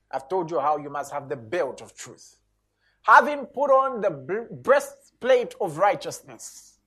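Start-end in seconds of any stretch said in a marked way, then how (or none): 3.0-6.8 loud, slow, proclaiming scripture read; preaching at full force. Acted. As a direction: proclaim each line to the congregation. Continues scripture reading.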